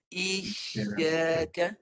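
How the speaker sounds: "Isyaka" drawn out very slowly with a clear sh; "Isyaka" slow, with a rising end; with shin. Shown as a demonstration. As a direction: stretch "Isyaka", raising mid-tongue on the sh. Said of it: "Mulut dilebarkan".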